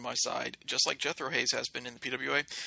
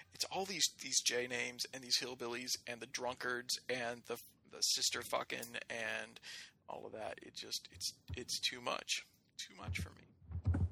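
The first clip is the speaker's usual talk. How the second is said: trailing off; 2 semitones lower, 9 dB quieter than usual. Real, not acted. Overwhelmed by hillbillies.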